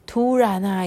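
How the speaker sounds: plain reading voice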